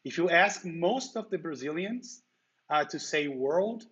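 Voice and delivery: ordinary talk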